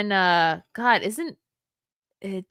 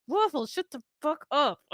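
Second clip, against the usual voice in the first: high, hushed mock scolding; retold exasperated scolding; 7 semitones higher, 4 dB quieter than usual. Owner silencing the dog in the baby's room, exasperated high scolding. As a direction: scold the dog high without raising volume.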